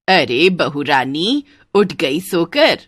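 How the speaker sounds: slow, drawn-out mother-in-law voice. Acted. Mother-in-law greeting the new bride in the morning, drawn out.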